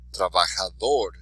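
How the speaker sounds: Trabajador with the final "dor" stressed; stress on last syllable. Shown as a demonstration. Teacher modelling words for students, last syllable of Trabajador stressed. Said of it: "accent on the last syllable".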